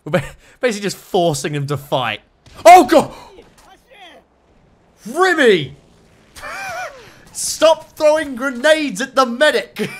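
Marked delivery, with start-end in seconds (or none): 2.6-9.9 high, loud yell through laughter; laughing shout. Real, not acted. Player yelling at a soldier grenading his own medic, laughing shout. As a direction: shriek it, half laughing, half horrified.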